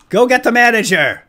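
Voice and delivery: high, loud heroic frog voice; hammed-up hero. Superhero frog to scientist, high loud heroic boast. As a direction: play the brave superhero bursting in.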